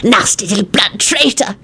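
venomous hiss in a creature voice. A narrator's reading. Weezer turning angrily on Timothy, hissing. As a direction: hiss the insult with contempt. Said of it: "he hissed".

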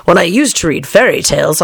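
ordinary delivery, nothing marked

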